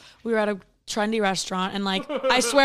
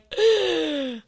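panicked gasp; 8 semitones higher than usual. Retold or imitated. Daughter imitating Dad hearing she has covid, panicked gasp. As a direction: suck in air as if starting to hyperventilate. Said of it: "panic. Hyperventilating, crying".

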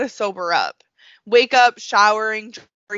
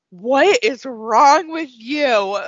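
disgusted disbelief; 3 semitones higher, 4 dB louder, much slower than usual. Retold or imitated. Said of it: "we were all like".

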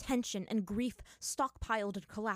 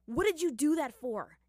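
higher, louder snarled question; 7 semitones higher, 6 dB louder than usual. Acted. Aang snarls Katara's question back at Zuko, louder. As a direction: spit it back at him. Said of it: "Aang snarled".

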